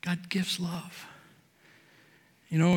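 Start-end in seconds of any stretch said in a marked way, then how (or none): 0.0-1.0 soft affirmation. Acted.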